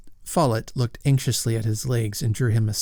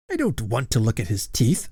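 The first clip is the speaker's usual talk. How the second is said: plain reading voice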